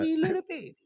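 put-on very high cooing baby talk. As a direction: coo it like to a newborn. Cooing over a newborn.

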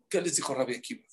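ordinary talk

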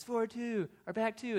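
high, sharp raft command; guide's call. Retold or imitated. Raft guide calling a stroke to paddlers, high call. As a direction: bark it from the back of the boat.

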